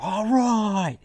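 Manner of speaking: high, very loud shout, stretched out; comic bellow of giving in; exasperated yell. Retold or imitated. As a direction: yell as if pestered past all patience. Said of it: "You don't expect him to yell at a kid".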